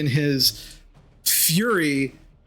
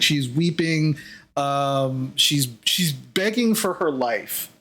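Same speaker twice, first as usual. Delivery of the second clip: normal talking voice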